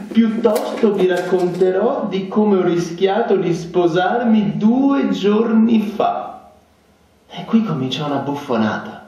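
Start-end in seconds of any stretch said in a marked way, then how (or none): 0.1-6.3 high, slow, showy storyteller voice; boastful officer. A narrator's reading. Zirkov showing off to the dinner guests, boastful. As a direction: hold the room with a braggart's flourish.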